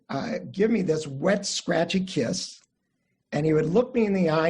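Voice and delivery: ordinary talk, nothing marked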